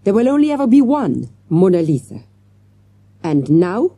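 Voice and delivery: put-on high, loud French-accented declaration; passionate. A narrator's reading. Detective defending the painting's uniqueness, passionate. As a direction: declare it fervently, as a French inspector. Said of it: "he added passionately".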